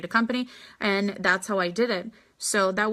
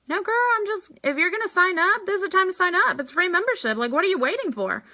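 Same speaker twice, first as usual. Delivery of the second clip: put-on much higher, eager girlfriend push; excited nudge; 9 semitones higher, faster than usual. Telling a prospect to sign up for free membership, bubbly and much higher. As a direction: hype her friend up to sign now.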